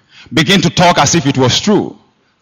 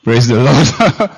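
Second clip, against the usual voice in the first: normal talking voice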